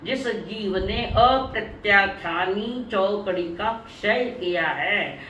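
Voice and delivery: very slow, deliberate restatement; teacher patiently spelling it out; slow emphatic repetition. Real, not acted. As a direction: draw out every word so it sinks in. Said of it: "बार बार कह रही हूँ".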